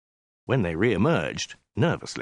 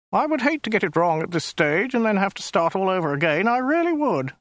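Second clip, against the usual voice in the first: high, thin alien voice; a small, prissy, weaselly official; 9 semitones higher than usual. Acted. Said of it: "in a small, weaselly voice".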